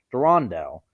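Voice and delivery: ordinary delivery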